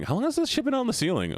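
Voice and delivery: sudden high-pitched disbelief; shocked. Real, not acted. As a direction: blurt it out in alarm. Discovers drawings all over ceiling.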